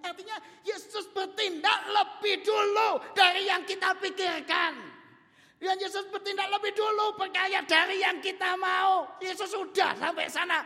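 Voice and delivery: loud, high fervent proclamation; preaching at full force. Acted. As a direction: preach it out to the congregation. Preaching climax.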